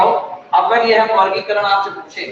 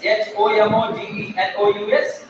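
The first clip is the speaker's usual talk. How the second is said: slow spelling; slower than usual. Shown as a demonstration. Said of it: "homogeneous".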